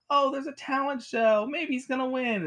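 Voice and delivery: high-pitched mock sitcom character; put-on high, eager voice; sitcom cheer. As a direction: chirp it like a cheesy sitcom discovery. Mock sitcom character spots easy solution.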